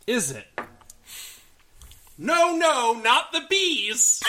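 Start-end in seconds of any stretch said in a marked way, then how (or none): 2.2-4.2 movie scream quote. Retold or imitated. Nicolas Cage quote.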